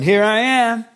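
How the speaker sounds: loud, high, cocky entitled son; arrogant swagger. Pretend. Preacher mocking an arrogant child barging in on God, cocky and loud. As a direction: barge in like the cocky favourite son. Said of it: "Never walk in on the Lord like that".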